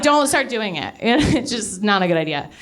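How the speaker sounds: ordinary delivery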